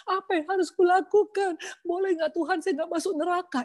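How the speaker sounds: reliving her desperate question after the divorce sermon; desperate crying plea. Retold or imitated. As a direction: beg for an answer in panic. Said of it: "sampai saya bilang".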